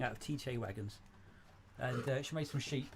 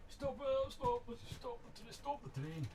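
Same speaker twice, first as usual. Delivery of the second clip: high-pitched mock Scottish accent; 11 semitones higher, slower than usual. Calling to halt the model train, playful Scottish accent. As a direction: put on a high, hammed-up Scottish voice. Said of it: "That's my bestest Scottish accent".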